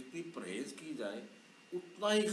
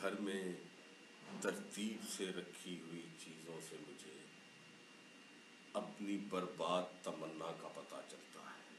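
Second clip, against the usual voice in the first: soft, measured ghazal reading; poem recitation; 7 semitones lower, 7 dB quieter than usual. Acted. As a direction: recite it softly, savouring each word. Poet recites next couplet.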